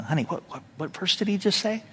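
hushed wife's question to husband; whisper. Shown as a demonstration. Wife whispering to husband during the sermon. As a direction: lean over and whisper it softly. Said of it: "you just whisper".

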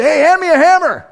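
worker calling out. Retold or imitated. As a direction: shout for the hammer over the noise. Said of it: "you say".

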